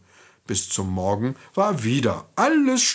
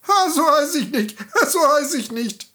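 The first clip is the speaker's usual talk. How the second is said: high, gleeful goblin with a laughing "ha"; triumphierendes Männlein; 13 semitones higher, 4 dB louder than usual. A narrator's reading. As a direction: crow it in a high little-man voice. Männlein weist die Namen zurück. (hohes, hämisches Männchen)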